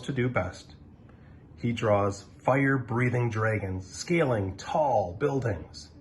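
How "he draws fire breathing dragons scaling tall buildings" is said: plain reading voice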